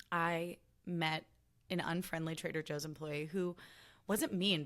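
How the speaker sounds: ordinary talk, nothing marked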